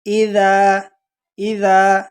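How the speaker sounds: اذا with ذ from the tongue tip, not ز. Shown as a demonstration. Teacher correcting students who say ز, careful recitation. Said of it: "زبان کی نوک سے ادا کریں گے".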